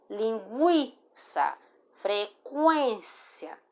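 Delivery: "Linguiça" and "frequência" drawn out syllable by syllable, U sounded. Shown as a demonstration. Teacher showing students the sound of the U, slow sounded-U demonstration of "Linguiça, frequência". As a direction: slowly show the U glide in "Linguiça, frequência". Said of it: "pra dar o som do U".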